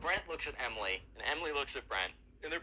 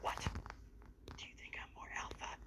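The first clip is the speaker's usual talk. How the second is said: much softer secret question; mock whisper; 9 dB quieter than usual. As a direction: lean in and whisper it. Emo kid whispers to Emily.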